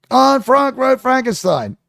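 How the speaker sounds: ordinary talk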